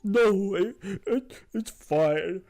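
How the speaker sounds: high, loud, tearful giant pleading; a big sad troll near tears, voice way up; weepy troll squeaking through tears; crying troll. Acted. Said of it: "he kinda looks up at you and says".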